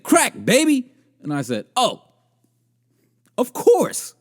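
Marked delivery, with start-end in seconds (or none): 0.0-0.9 high, loud mock exclamation; acted hooker's answer. Acts out hooker's blunt answer. 1.8-4.1 high-pitched, put-on understanding; mock realization. Acts own reaction to her answer.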